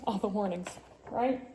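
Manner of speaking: ordinary delivery, nothing marked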